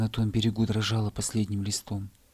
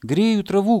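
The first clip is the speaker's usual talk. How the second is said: higher, louder shout to be heard; 10 semitones higher, 8 dB louder than usual. Acted. Hare shouting back up to the bear cub. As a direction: yell it up the hill. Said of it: "крикнул заяц".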